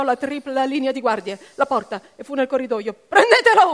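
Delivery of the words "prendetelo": urlato forte e acuto; urlo di comando. A narrator's reading. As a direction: ordina alle guardie di inseguirlo. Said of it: "urlò Baines". (Baines urla un ordine alle guardie)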